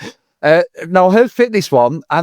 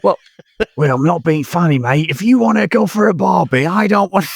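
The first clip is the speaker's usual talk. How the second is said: mock Cockney geezer, pitched up; Ray Winstone Cockney; 5 semitones higher than usual. Cockney tough guy to co-host, chummy and mock-tough. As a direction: ham up a London hard man. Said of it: "Cheers, Ray Winston".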